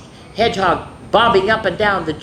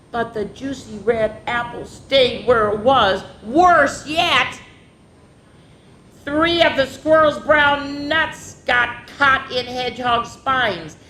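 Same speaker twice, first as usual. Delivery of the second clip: lively, sing-song storybook voice; reading to children; 5 semitones higher than usual. A narrator's reading. Teacher reading a picture book to daycare kids, lively. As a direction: read it like story time for little ones.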